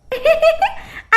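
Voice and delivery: put-on squeaky girlish giggle; high giggle. Bride giggling at her worried husband. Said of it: "पायल हंसते हुए कहती है".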